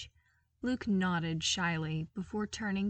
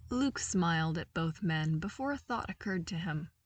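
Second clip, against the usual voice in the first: ordinary delivery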